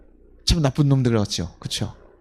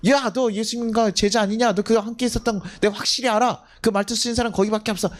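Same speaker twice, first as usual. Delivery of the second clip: high, insistent accuser; acted accusation; 8 semitones higher than usual. Bystander recognizes Peter by his dialect, insistent accusation. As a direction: point the finger in a raised pitch.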